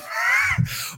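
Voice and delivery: a sudden high-pitched giggle; high squeal laugh. Real, not acted. Laughs before naming peak.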